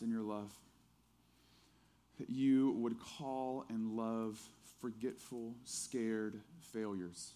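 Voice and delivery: hushed, low-pitched prayer to the Lord. Real, not acted. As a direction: pray quietly, pausing between phrases. Leads a closing prayer.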